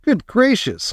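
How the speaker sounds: bright high cry of surprise; exclaiming. A narrator's reading. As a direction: cry it out in wonder. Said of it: "cried Charlie".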